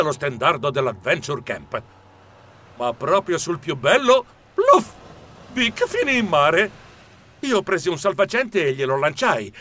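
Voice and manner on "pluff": sudden high, loud "pluff"; splash noise. A narrator's reading. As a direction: pop it out like a splash. Splash as girl falls in. (tonfo in acqua, acuto e improvviso)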